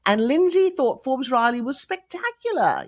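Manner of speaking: slow, put-on British accent; Lindsay's British accent. British agent gushing about her client, in a British accent. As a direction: drawl it out in a posh-sounding British accent. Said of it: "That's a good British accent".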